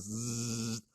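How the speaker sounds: soft buzzing zzz like a sliding door; door hiss sound. Retold or imitated. Telling how the cell door slid open, soft imitated buzz.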